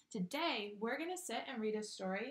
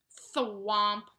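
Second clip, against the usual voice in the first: louder thwomp of a landing; hammed-up landing thud; 11 dB louder than usual. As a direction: hit it like a heavy flop. Pig landing sound effect.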